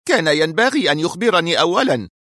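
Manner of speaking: high-pitched, exasperated complaint; irate boss voice; furious. A narrator's reading. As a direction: burst out in indignation. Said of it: "هتف المدير في حنق".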